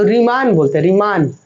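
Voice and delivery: रीमान with a wide-open long aa, "ree-MAAN"; long-aa pronunciation. Shown as a demonstration. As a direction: open the mouth big for the second vowel of रीमान, "ree-MAAN". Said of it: "जिसमें आ का".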